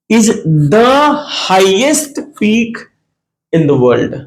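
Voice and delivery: highest peak stretched out very slowly; slow dictation. Shown as a demonstration. Teacher reads the example answer to students very slowly. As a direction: drag each word for the class to copy.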